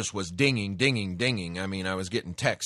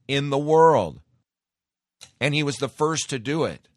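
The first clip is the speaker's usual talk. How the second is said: much louder and higher, insisting; emphatic; 7 semitones higher, 9 dB louder than usual. Retold or imitated. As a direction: hammer the point home. Heated argument at gas station.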